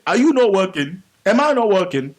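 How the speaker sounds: loud, indignant mimicked questions; quoting the wife. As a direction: throw out the wife's challenge, imitating her loudly. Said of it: "where she was saying".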